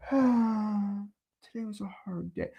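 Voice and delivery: slow, soft, sorry-for-himself whine; mopey complainer. Retold or imitated. Mocking an immigrant who complains, in a slow soft whine. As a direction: drag it out like a defeated quitter.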